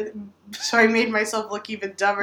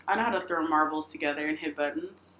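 ordinary talk

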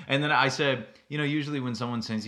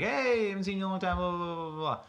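quick, higher, breezy greeting; imitating the dude; 9 semitones higher, 3 dB quieter than usual. Coaching a friend, lightly acted pickup chatter. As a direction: breeze through the small talk. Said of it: "you're like".

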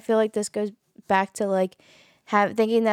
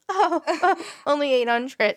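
higher-pitched incredulous laughing quote; mock disbelief; 6 semitones higher, much slower than usual. Reacting to a stripper's slow night, mock disbelief. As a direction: act mock-shocked at a modest complaint.

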